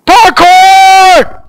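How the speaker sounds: high, loud parkour cry; excited shout. Real, not acted. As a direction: yell it as you leap. Jumping in the game.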